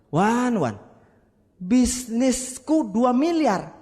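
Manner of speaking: a hint of a dismissive businessman; lightly voiced Makassar friend.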